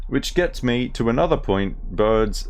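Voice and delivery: broad ocker Australian voice, played for laughs; reading a butterfly book as a brash Australian; mock Australian accent. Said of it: "we're going with the Darrow Australian".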